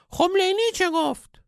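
very high, piping wise-man voice; comic falsetto old master; squeaky old sage. A narrator's reading. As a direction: play the sage as a squeaky cartoon guru.

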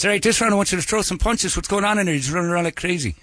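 Irish trainer. Retold or imitated. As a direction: urge the boxer in an Irish accent. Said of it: "Irish boxing trainer".

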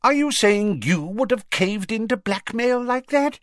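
high-pitched offended challenge; affronted, heated question; indignant. A narrator's reading. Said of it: "colouring up".

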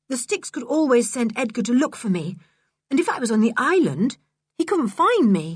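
worried George. Acted. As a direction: voice an anxious child declining an outing. Narrator voices worried George.

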